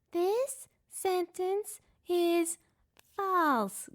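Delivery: very slow, high and loud; slow robot voice. A narrator's reading. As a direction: drag out each word like a toy. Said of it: "Gur said".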